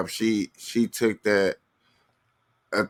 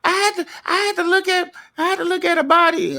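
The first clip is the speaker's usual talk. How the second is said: much higher, fast woman's voice; imitating her; faster than usual. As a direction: blurt it out in a high rush. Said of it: "She's like".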